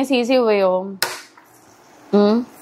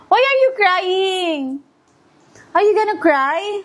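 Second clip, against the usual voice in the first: coaxing concern; 7 semitones higher than usual. Real, not acted. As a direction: ask in a high, cooing parent voice. Parent coaxing an upset child.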